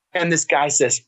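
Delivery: usual speaking voice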